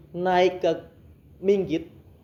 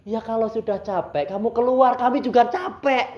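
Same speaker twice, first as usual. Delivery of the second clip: louder, higher retort; exasperated command; 6 semitones higher, 5 dB louder than usual. Retold or imitated. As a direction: re-enact a fed-up order to get out. Weary exorcist orders the spirit out. (membentak kesal, menirukan perintah)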